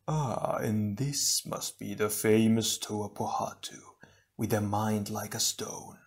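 slow, soft, otherworldly coo; an unseen evil voice teasing a hero; cooing villain. Acted. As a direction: purr the mockery sweetly. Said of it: "the mysterious voice cooed".

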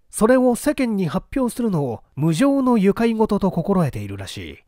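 ordinary delivery, nothing marked

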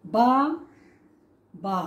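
বা, বা said as two separate beats; syllable by syllable, বা then বা; split into syllables. Shown as a demonstration. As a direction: pause between each বা. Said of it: "আমরা বলছি যে".

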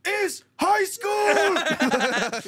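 high, slow shout through laughter; laughing shout. Real, not acted. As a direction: yell it while cracking up.

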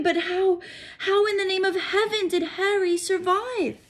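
put-on high, indignant older woman; high stern witch in disbelief; shocked McGonagall. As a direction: voice her outrage in a lifted register. McGonagall voiced, outraged disbelief.

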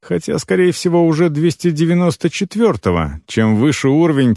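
usual speaking voice

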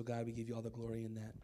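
ordinary talk, nothing marked

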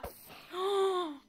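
loud, sharp gasp of fright; frightened gasp. Real, not acted. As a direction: gasp as if badly startled. Fright after close attack.

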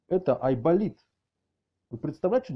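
normal talking voice

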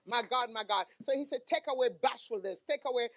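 loud, emphatic preacher's delivery; loud prophetic exhortation; forceful preaching. Real, not acted.

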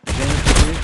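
loud call across the map; shouting to a teammate mid-fight, loud. Real, not acted. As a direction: yell it out to Zeynep.

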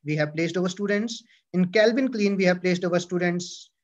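ordinary talk, nothing marked

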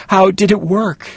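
high loud cry; sharp high exclamation of distress; cried out. A narrator's reading. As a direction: burst out desperately. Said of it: "I cried".

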